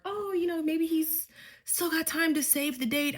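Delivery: put-on dreamy naive teen; lightly voiced hopeful girl; mock hopeful. Mocking hopeful thought.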